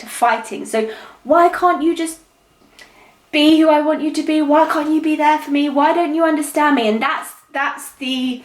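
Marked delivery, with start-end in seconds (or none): 1.2-6.9 higher-pitched, pleading reproach; acted arguing. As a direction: act out one side of a couple's quarrel, pitched up. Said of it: "They are the arguments that went on".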